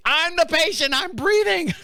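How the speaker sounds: high, comic protest; mock-frantic caller voice; exasperated caller. Exasperated caller answering clueless dispatcher.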